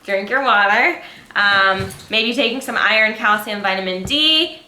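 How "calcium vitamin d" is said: usual speaking voice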